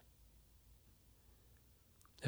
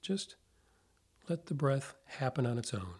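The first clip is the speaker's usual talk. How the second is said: guided meditation; 4 dB quieter than usual. Acted. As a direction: ease them into calm, softly, with long pauses.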